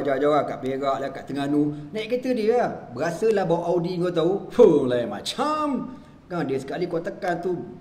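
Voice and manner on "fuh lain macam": marvelling. Retold or imitated. Marvelling at riding the Audi.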